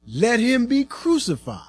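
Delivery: mild shout, pitched high without much volume; restrained crowd shout. A narrator's reading. The crowd demands crucifixion of Pilate, restrained shout. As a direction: call it out high, holding back the force. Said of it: "Again they all shouted".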